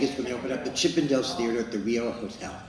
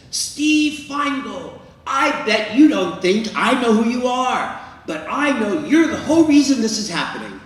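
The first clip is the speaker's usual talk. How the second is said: booming high-pitched public proclamation; really loud voice; 10 semitones higher, 8 dB louder than usual. Retold or imitated. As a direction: call it out loudly for the whole room to hear. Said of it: "in a really loud voice".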